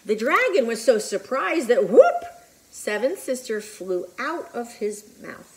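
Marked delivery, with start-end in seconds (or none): 1.9-2.2 high, loud whooping sound effect. Acted. Baby shooting out of the dragon's mouth, loud high whoop. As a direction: pop it out like something flying free.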